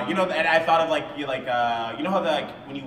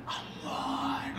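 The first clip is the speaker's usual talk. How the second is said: breathless whisper, much softer; whispering that he doesn't love her; whispered denial; 8 dB quieter than usual. Retold or imitated. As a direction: hide the words in a whisper. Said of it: "the way you made him whisper it".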